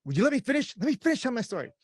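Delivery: irritated mock protest; exasperated protest. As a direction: scold the one who interrupts. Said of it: "she always will go".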